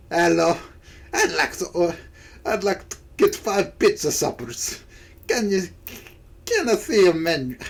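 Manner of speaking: rough attempt at a Scottish customer; hard-edged amateur Scottish accent. Nessie ordering pizza suppers, attempted Scottish accent. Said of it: "how to do a Scottish voice".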